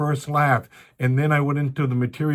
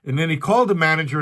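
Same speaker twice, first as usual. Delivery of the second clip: usual speaking voice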